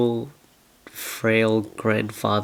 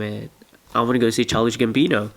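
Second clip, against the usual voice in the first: ordinary delivery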